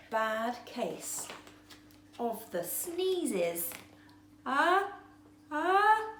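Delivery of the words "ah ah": rising gasps before a sneeze; sneeze build-up. A narrator's reading. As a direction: breathe in higher and higher, about to sneeze.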